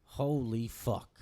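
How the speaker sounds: drawn-out, slow retold disbelief; stunned amazement. Man floored by a palm reading, slow stunned reaction. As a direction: stretch each word in awe.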